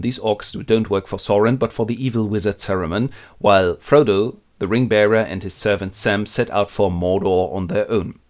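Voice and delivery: ordinary delivery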